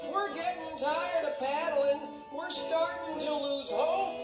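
high-pitched whiny complaint; acted storybook griping; moping beavers. A narrator's reading. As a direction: mope aloud like weary beavers. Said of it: "they all began to mope".

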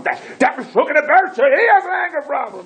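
very high imitated shout; hammed-up outburst in a screechy high pitch; mock yelling. Caller agrees Bercha has an anger problem, mock yelling.

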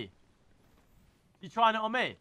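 ordinary talk

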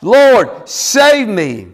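high, imploring call; crying out, acted. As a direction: act out a desperate cry for help. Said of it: "Peter cried out".

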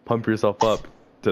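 ordinary delivery

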